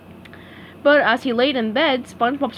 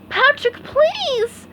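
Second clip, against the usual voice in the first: high pleading call; much slower than usual. Acted. SpongeBob begs Patrick to stop, high pleading call. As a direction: cry out to stop a friend. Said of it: "called SpongeBob".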